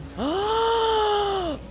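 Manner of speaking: sharp high gasp of delight; excited gasp. Real, not acted. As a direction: gasp in amazement at the mount. Excited at riding the creature.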